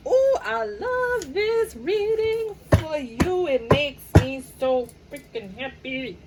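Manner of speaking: high, slow, sing-song gush; drawn-out delighted coo. Acted. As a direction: swoon over the reading. Excited reader gushing over cards.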